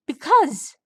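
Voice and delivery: loud, high outburst; frustrated shout. Acted. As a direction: burst out with it.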